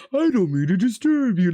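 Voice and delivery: a lightly voiced timid, well-mannered ghost; polite ghost voice. Imagining the crying ghost's manners, lightly voiced polite ghost. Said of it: "that's how he would sound".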